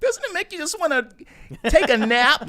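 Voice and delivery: very high, loud mock soothing squeal; a screechy lullaby as a joke; high-pitched screeching whine. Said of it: "That soothing, high-pitched, screeching whine".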